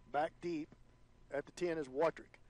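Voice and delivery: ordinary delivery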